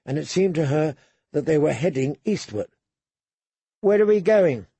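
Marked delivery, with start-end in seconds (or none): 3.8-4.7 put-on higher girlish voice, asking; a lightly voiced young girl. A narrator's reading. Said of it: "she asked him".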